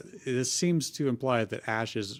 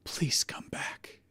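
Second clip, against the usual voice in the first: slow, soft whisper; 5 dB quieter, slower than usual. Acted. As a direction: whisper it slowly, pleading. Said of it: "he whispered".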